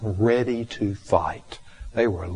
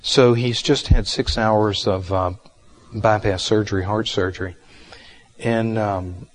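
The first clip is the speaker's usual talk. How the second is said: louder, animated read-aloud of a memoir; story reading; 6 dB louder than usual. Acted. Teacher reading a surgery story to students, lively and loud. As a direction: read the passage out to the group with energy.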